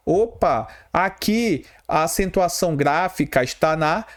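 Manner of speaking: ordinary talk, nothing marked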